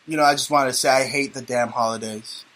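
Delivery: ordinary talk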